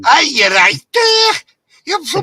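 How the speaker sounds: high, loud mock Scouse; Scouse accent. To the Scouse friend, a loud mock Scouse. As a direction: ham up a Liverpool Scouse voice. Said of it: "A Scouse accent".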